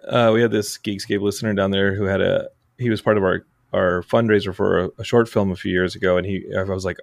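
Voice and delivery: usual speaking voice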